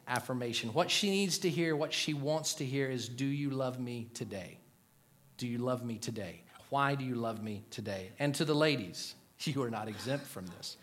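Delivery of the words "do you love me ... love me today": low, soft questioning; hushed, earnest asking; quiet pleading. Acted. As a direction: gently voice a wife's need to be loved. Voices wife's unspoken need.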